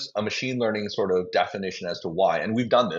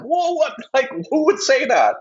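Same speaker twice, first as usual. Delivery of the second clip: incredulous laugh; 6 dB louder than usual. Real, not acted. Mocking a CEO's wild claim.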